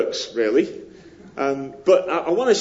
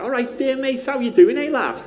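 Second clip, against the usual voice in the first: high, bright put-on Liverpool lad greeting; Scouse accent; 10 semitones higher than usual. Shown as a demonstration. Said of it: "I can do things like".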